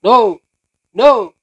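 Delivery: sharp, loud command to a dog; firm loud no. Real, not acted. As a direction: shout a stern no at the dog. (larangan keras dan tegas)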